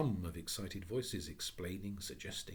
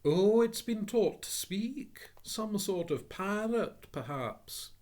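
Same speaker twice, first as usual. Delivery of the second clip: much higher, louder, slow startled voice; jumping at a talking bird; startled gentleman; 13 semitones higher, 10 dB louder, slower than usual. Acted. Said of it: "and the gentleman jumped".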